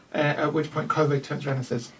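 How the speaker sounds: ordinary delivery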